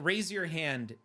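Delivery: ordinary talk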